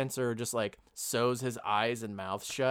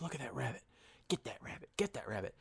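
hushed whisper, egging the cat on; 12 dB quieter, faster than usual. Retold or imitated. Urging cat to get rabbit.

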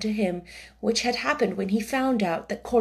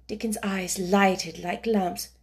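ordinary delivery, nothing marked

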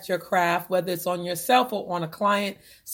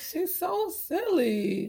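laughing; 9 semitones higher, much slower than usual. Real, not acted. Giggling at co-host's mix-up.

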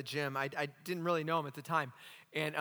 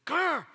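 high, loud mock growl; short growled summons; imitated growl; 10 semitones higher, 6 dB louder than usual. Scary man calling the pastor over, mock growl.

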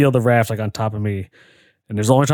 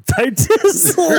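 high, slow, silly drawn-out word; mock high voice; 4 dB louder, much slower than usual. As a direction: stretch it out high and goofy. Mocking the silly title.

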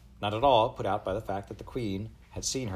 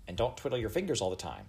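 ordinary delivery

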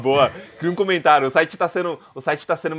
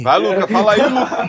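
laughing; 7 semitones higher, 7 dB louder than usual. Real, not acted. Teasing friend, laughing.

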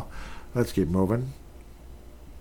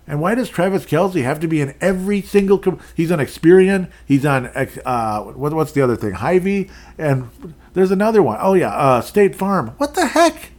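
rising, much higher and louder disbelief; exasperated rant; 6 semitones higher, 5 dB louder than usual. Real, not acted. Host venting to listeners about Kelce's endless ads, high and loud. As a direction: get more worked up with every ad you name.

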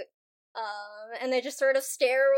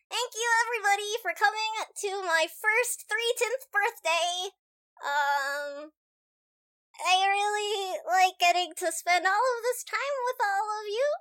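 put-on child's speech; 8 semitones higher than usual. Ro's speech from atop a chair.

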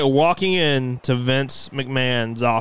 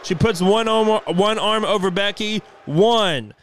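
excited ringside commentary, higher; live play-by-play; 4 semitones higher than usual. Acted. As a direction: call the match like a wrestling announcer.

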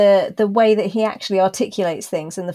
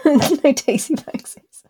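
laughing whisper; 7 semitones higher than usual. Real, not acted. Echoing co-host's teasing phrase.

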